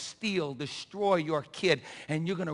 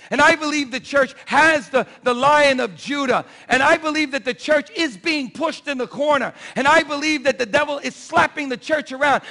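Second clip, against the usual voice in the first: loud, ringing sermon delivery; fiery preacher stirring the congregation; preaching at full force; 7 semitones higher, 11 dB louder than usual. Acted. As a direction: preach it out to the whole church. Preacher building to a climax.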